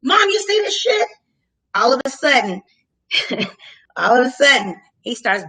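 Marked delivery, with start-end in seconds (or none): 0.0-1.1 incredulous call. Retold or imitated. Calling to her mom during the stop, incredulous. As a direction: call out in disbelief.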